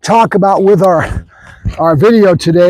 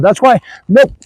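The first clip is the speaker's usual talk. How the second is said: usual speaking voice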